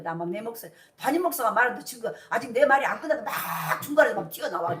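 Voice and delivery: normal talking voice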